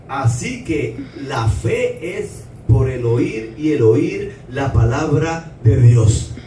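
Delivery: slow, deliberate verse reading with weighty pauses; scripture reading. Acted. Preacher reads Romans to the congregation, slow scripture reading. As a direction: read it out slowly, phrase by phrase.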